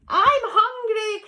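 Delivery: high, loud, slow "I'm hungry"; crying out. Acted. Squirrel Cyril wails to Bruce, loud and high. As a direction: wail it up high. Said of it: "cried Cyril".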